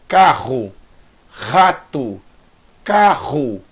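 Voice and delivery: "Carro, rato, carro" with throaty R, spaced apart; guttural Het sound. Shown as a demonstration. Teacher drills the Het sound, guttural R in "Carro, rato, carro". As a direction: rasp each R in "Carro, rato, carro", pausing between words. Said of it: "mesmo som do Het".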